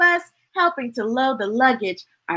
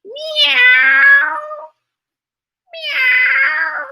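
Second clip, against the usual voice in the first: very high cat meow; high, squeaky meow; 14 semitones higher, 4 dB louder than usual. Acted. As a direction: mew like a little cat. Cat meows.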